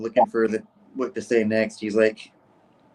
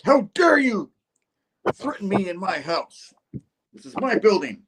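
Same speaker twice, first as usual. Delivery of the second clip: high, indignant mock rage; full goblin fury; 9 semitones higher than usual. Norman tearing into the man who attacked him, high furious rant. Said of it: "Norman just going full goblin".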